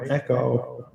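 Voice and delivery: echoing mic demo; reverberating echo; echo effect. Shown as a demonstration. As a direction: let the word trail off in echoes. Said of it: "You can see what the echo sounds like".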